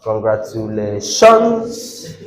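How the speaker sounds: congratula-TIONS, final TIONS jumping up and held; stress on last syllable. Shown as a demonstration. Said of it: "Now let's continue".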